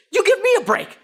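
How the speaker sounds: very high, loud incredulous retort; indignant yell. Retold or imitated. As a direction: throw it back at her, outraged, acting the man. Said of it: "he yells one more time".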